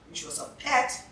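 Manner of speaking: ordinary talk, nothing marked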